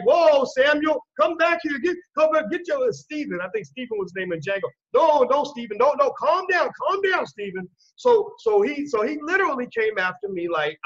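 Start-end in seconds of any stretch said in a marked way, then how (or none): 0.0-2.9 higher, louder calls to hold him back; master reining him in. Retold or imitated. Master calling back his overexcited servant, high urgent. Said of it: "the masses like". 4.9-7.7 master reining him in. Retold or imitated. Master calling back his overexcited servant, high urgent. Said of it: "the masses like".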